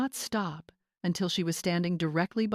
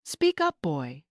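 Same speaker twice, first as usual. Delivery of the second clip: shout; 2 semitones lower, 3 dB louder, slower than usual. A narrator's reading. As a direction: yell at him to speak up. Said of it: "Stan shouted".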